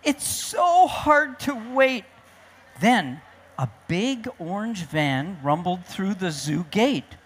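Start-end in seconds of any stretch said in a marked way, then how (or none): 0.0-2.0 slow, high-pitched complaint; whiny storybook grumble; grumbling pandas. Acted. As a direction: drag it out impatiently. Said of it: "grumbled the pandas".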